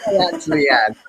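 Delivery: ordinary delivery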